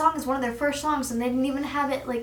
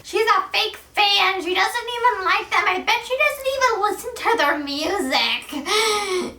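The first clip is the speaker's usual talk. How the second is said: pitched-up mocking fake-fan accuser; mocking doubter voice; 9 semitones higher than usual. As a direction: sneer it like a snotty hater. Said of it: "you guys are like".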